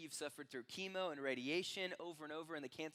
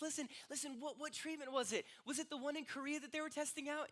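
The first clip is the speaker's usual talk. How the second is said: high-pitched, eager doctor; urgent amazed questioning; 10 semitones higher than usual. Acted. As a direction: press for the answer in an excited high voice.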